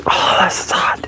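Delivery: slow breathy whisper through pain. Real, not acted. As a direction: whisper it, wincing. Burned by hot metal.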